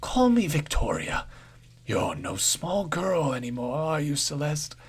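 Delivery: creepy crone ending in a whisper; eerie old woman. A narrator's reading. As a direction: draw her closer, secretive. Brand voiced in story.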